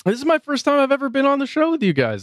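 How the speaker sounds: high, fast hammed-up excitement; mock excited. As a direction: gush like a first-time guest. Jokey excited mock guest.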